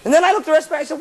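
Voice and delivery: ordinary talk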